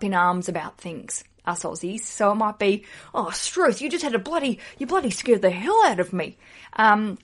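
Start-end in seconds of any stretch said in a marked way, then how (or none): 3.1-6.4 lightly voiced startled Aussie dad; a hint of dad getting a fright; mild mock fright in a broad Aussie dad voice; Dave McCormack impression. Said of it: "I've just basically done a Dave McCormack expression".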